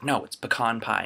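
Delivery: "pecan" as puh-KAHN, correcting; grandmother's "pecan". Retold or imitated. Grandmother correcting the family, "pecan" as puh-KAHN. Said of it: "she's always like".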